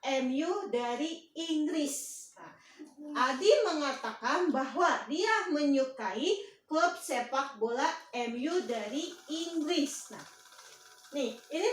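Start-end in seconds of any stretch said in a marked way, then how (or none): 0.0-2.3 very slow, word-by-word read-out; deliberate classroom dictation, drawn out. Shown as a demonstration. Teacher dictates the reported sentence. 3.3-10.1 slow, measured repeat for the class; deliberate classroom dictation. Shown as a demonstration. Teacher repeats the dictated sentence.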